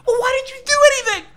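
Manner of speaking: very high, loud squealed protest; hammed-up squeaky complaint, rushed at first; mock exasperation. Acts out his exasperated reply to the American.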